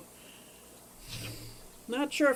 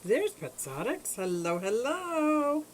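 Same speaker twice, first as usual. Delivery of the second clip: high, slow sing-song greeting; cooing at fish; 7 semitones higher, slower than usual. Real, not acted. Greeting her fish on camera, high slow coo. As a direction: coo hello to a pet fish, drawn out.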